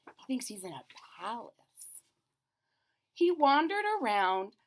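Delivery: ordinary delivery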